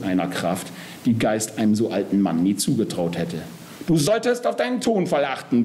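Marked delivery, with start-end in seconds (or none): 3.9-5.6 high-pitched mock roar, no great volume. A narrator's reading. Death grabbing Geist by the collar, roaring in contained anger. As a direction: rebuke him in a contained bellow. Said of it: "brüllt er".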